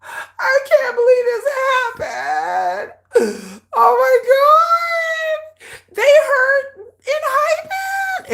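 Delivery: hammed-up crying fan, high and wailing; fake-sob it like a devastated fan; overdone wail on oh my God; mock sobbing. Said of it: "everybody's gonna be sitting around going".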